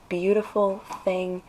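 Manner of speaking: normal talking voice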